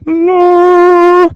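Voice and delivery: loud, sustained high note; high-pitched. Shown as a demonstration. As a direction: hold one long piercingly high "la". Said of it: "is a high-pitched noise".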